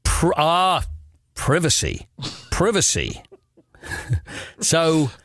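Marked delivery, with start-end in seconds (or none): 1.3-3.2 "privacy" with a short i as in "privy"; first syllable of "privacy" rhymes with "give"; British pronunciation of privacy. Shown as a demonstration. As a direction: say "privacy" the British way, PRIV-uh-see. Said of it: "How do you pronounce that?".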